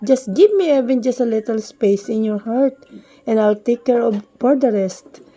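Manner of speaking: plain reading voice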